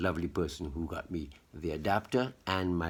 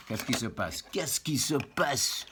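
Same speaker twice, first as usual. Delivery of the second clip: French exclamation rising much higher on the repeat; mock French dismay; 6 semitones higher than usual. To a gadget that misbehaves, in mock French dismay. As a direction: fret theatrically in French, building in pitch.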